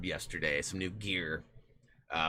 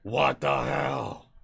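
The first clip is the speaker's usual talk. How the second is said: put-on Gray accent; 4 semitones higher, 6 dB louder, much slower than usual. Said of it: "throw the gray accent on there".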